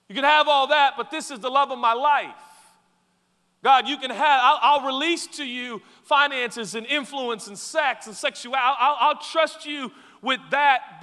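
loud, high, pleading voice; loud imagined prayer to God. Pretend. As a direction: beg God, holding back one thing. Voicing a believer praying to God.

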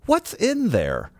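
high, slow, puzzled customer voice; quizzical customer. Retold or imitated. Customer at takeout counter asks host about box, high and slow. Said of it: "she goes".

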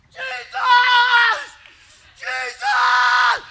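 high, all-out scream of the name; reliving a boyhood scream, high and desperate. Retold or imitated. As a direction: scream it with everything you've got. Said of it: "I start screaming at the top of my lungs".

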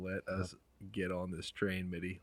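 ordinary delivery

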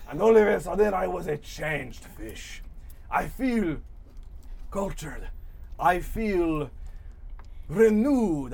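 lightly voiced, grandiose storybook fish; pompous fish character. GM voices Mustache Fish monologue.

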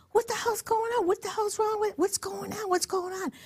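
high, urgent what's going on; retold confused outcry; baffled demand. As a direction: demand answers in alarm. She asks what's wrong.

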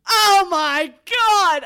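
slow, high, loud "oh my God" in disbelief; a stunned, drawn-out cry of disbelief; shocked gasp. Retold or imitated. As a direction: let it burst out stunned and drawn out. Retelling her shocked reaction.

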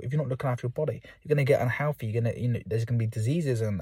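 ordinary talk

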